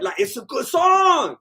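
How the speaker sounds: high, slow, loud gushing; swooning shout of praise; excited praise. Real, not acted. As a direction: belt out how much you love it. Gushing over the song.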